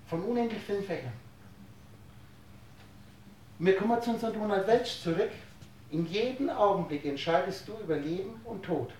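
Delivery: ordinary talk, nothing marked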